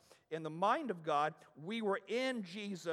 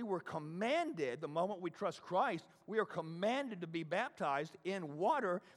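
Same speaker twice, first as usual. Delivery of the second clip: usual speaking voice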